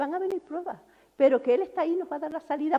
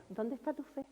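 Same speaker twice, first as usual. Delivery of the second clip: gentle question; 15 dB quieter than usual. Retold or imitated. Jesus asks quietly.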